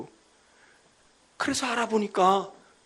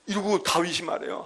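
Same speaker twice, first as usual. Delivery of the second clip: ordinary delivery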